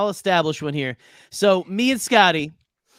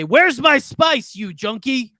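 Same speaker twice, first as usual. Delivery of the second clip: loud, high, slow demand; angry shout; 8 semitones higher, 4 dB louder, slower than usual. Acted. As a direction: yell it in his face, dragging it out. Grabs rival by the collar.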